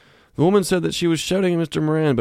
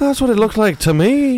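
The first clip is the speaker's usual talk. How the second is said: loud, high put-on character voice; storyteller from Jaws; 9 semitones higher, 5 dB louder than usual. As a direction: plead your case in a loud, high tall-tale voice. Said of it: "You sound like you're from Jaws".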